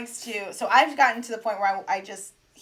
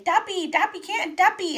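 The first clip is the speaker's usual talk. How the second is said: high-pitched insistent toddler mock yell; 9 semitones higher than usual. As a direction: imitate a little kid shouting the word at you. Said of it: "He's, like, yelling at me".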